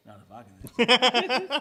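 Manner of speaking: slow, loud, drawn-out retort; mock reply. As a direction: stretch each word out loud. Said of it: "You be like".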